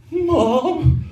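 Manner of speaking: high, thin call for a parent; hammed-up high-pitched plea; pleading mock call. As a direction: imitate a stranded kid calling up high. Stranded son calls to parents.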